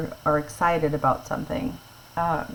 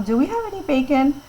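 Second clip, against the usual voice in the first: asking mom; 8 semitones higher, 4 dB louder than usual. Retold or imitated. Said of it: "to your mom".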